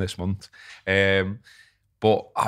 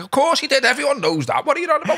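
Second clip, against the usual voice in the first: high, loud mock Welsh fella; Welsh accent; 4 dB louder than usual. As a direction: squeal it out like an outraged Welsh local. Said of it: "I'm not doing the Welsh impression".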